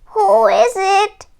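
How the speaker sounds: lightly voiced granny calling. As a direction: call through the door as Granny. Called out Granny.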